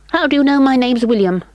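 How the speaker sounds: put-on a small boy's polite voice. A narrator's reading. William voiced.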